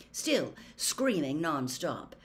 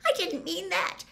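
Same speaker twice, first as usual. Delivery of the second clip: high, faltering, panicky plea; horror-struck squeak; 14 semitones higher, 3 dB louder than usual. A narrator's reading. Hermione tries to take back her mistake, high horrified squeak. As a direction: squeak out a halting apology. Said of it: "in a horror-struck squeak".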